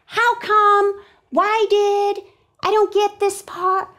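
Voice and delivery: high-pitched, questioning inner voice; a confused student's high voice; puzzled reader. Acted. Acting out a puzzled reader's thoughts.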